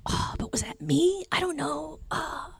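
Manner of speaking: high, breathy unsure whisper to yourself; high timid whispered self-doubt; anxious inner voice. Retold or imitated. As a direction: whisper like a kid second-guessing every thought. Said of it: "They're, like, battling in their minds".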